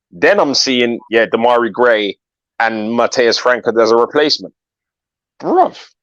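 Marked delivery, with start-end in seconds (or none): 5.4-5.9 a squeaky high bruv of disbelief; high-pitched gasp of a word; stunned bruv. Real, not acted. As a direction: let it fly up in shock. Shock at transfer news.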